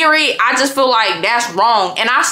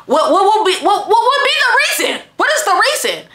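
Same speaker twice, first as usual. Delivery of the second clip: pitched-up, exasperated what would be the reason; demanding a reason from the boyfriend, exasperated; high exasperated demand; 9 semitones higher than usual. Retold or imitated. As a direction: press him for an answer in disbelief. Demanding an explanation.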